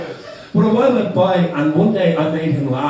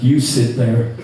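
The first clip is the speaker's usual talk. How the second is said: put-on low, blunt Romanian-accented order; Pavel's Romanian accent; 5 semitones lower, slower than usual. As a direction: bark it like a huge Romanian bouncer. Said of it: "he went".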